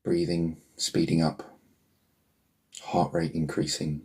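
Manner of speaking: ordinary delivery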